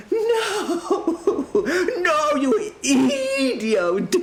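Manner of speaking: very high, wailing outraged shout; raging herald. A narrator's reading. Herald corrects the mishearing defender, high raging wail. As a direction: shriek it in drawn-out comic fury. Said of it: "said the raging man".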